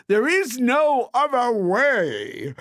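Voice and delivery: slow, high, drawn-out pronouncement; smug rich people. A narrator's reading. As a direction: lay down the law like a smug tycoon. Rich people's slogan in story.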